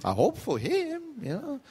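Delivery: amused, laughing while hoping. Real, not acted. Laughs at own joke.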